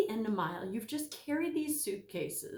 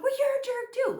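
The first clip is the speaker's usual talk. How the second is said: mock retort; 6 dB louder than usual. Mock retort in example quarrel.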